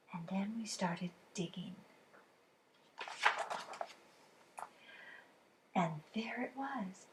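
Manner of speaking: hushed narrator whisper; suspenseful whisper. A narrator's reading. Suspense of the dig.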